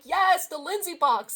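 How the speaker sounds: gleeful shout-out; excited cheer. Retold or imitated. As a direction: cheer as if the box just arrived. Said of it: "I'm like".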